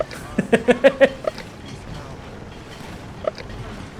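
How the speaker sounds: airy unvoiced laughter; breathy laugh. Real, not acted. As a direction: laugh quietly through breath. Laughing at loud smithy.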